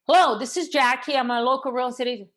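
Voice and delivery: match the caller's loud quick pace; brisk fast agent intro; matched energy. Shown as a demonstration. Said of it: "And you go".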